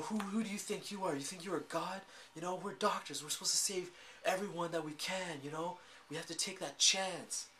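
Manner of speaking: a light imitation of a woman lecturing a man who let a patient die; mock scolding heroine.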